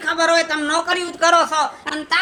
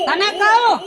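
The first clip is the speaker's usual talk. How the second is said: louder calling across the house; calling out loudly; 5 semitones higher, 4 dB louder than usual. Acted. Husband shouting for his wife to come, loud call. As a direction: yell for the wife from another room. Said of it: "આટલી બધી રાડો શું કામ પાડો છો".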